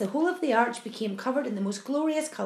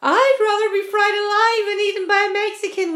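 loud wail; 10 semitones higher, 9 dB louder than usual. A narrator's reading. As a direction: wail in utter dread. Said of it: "wailed the old green grasshopper".

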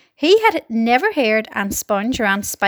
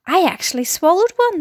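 higher-pitched, pleased boast; proud declaration; 7 semitones higher, 3 dB louder than usual. A narrator's reading. Earthworm telling the others his feat, proudly. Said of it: "the earthworm declared proudly".

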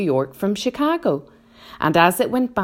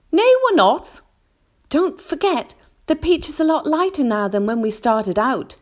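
James telling them; 10 semitones higher than usual. A narrator's reading. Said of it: "James told them".

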